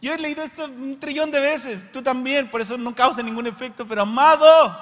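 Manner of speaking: high, loud, urgent sermon voice; fiery preaching. Real, not acted. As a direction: preach it hard to the crowd. Preaching at full force.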